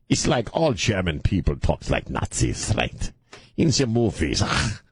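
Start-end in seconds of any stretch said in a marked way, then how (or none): none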